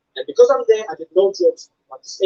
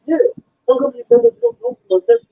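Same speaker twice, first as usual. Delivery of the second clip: much higher, slurred insistent repeat of the question; imitated deaf fan repeating; 3 dB louder than usual. Fan repeating his question to the comedian, slurred imitation. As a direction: push the question again, a bit louder. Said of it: "He said".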